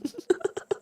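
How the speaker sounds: soft unvoiced chuckle; quick airy laugh; breathy laugh. Real, not acted. No sun joke.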